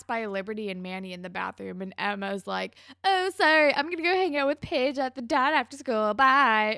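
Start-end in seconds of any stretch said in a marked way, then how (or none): 3.0-6.8 loud, high, bragging singsong; braggy. Retold or imitated. Emma bragging to Liberty and Manny, loud and smug. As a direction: rub it in their faces, showing off. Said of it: "very braggy and in their face about it".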